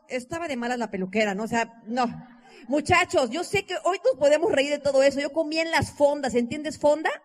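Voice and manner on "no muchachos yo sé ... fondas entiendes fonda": ordinary talk, nothing marked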